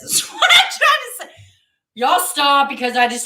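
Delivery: bursts into a high laugh mid-sentence. Real, not acted. Admitting to viewers she can't take compliments, laughing loudly. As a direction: laugh through the admission, loud and high. Laughing at her own confession.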